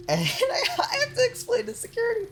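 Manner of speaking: words squeaked out high through laughter; real laughing. Real, not acted. Streamer recalls explaining it to security, cracking up high. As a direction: talk while cracking up. Cracking up while telling the story.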